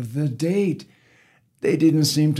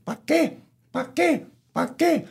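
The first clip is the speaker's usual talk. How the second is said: very high, short clucked questions; hen clucking; faster than usual. Acted. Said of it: "the hen clucked her question".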